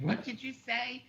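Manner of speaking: high, slow challenge; mock indignant manager. Shopper voicing the manager challenged by a curse, mock indignant.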